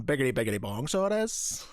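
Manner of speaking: cartoon Irish accent; stage Irish. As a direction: lay the Irish on thick. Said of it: "You should have just gone".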